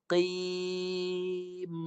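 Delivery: "Qim" with heavy q, long chanted vowel, hummed m; thick, held sound. Shown as a demonstration. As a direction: draw out a thick "Qim" into a hum.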